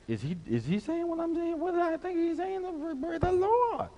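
high-pitched mock whispery youngsters' voice; mimicking young people. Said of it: "They're like".

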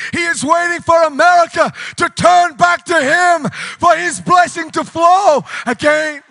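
loud, high-pitched revival preaching; full-force preaching. Real, not acted.

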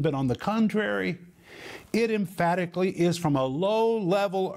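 high, declamatory preacher's reading; emphatic scripture reading. Acted. Preacher reading out his rendering of the verse, emphatic. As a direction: drive the verdict home, pitch held up. Reads paraphrase of next verse.

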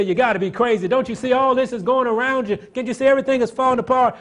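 high, loud scoffing taunt; mocking ridicule. The world mocks the believer in the storm, loud high scoffing. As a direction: jeer at the believer as if he's crazy.

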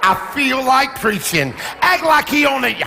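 loud, high shouted preaching; full-force preaching. Acted. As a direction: raise the roof with the sermon.

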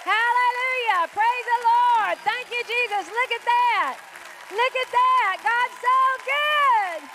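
loud, high shouts of praise; jubilant praise. Real, not acted. Pastor celebrating someone's healing with the congregation, jubilant. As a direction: exult at full voice, stretching the hallelujahs.

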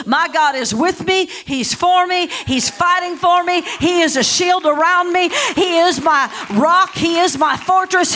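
high, emphatic declaration of faith; rousing pulpit proclamation; full-force preaching. Acted. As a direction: proclaim it defiantly, punching each phrase.

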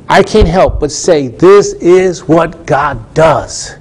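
slow, stretched words for weight; emphatic preaching. Real, not acted. Preacher testifying to miracles seen with own eyes, emphatic and drawn out.

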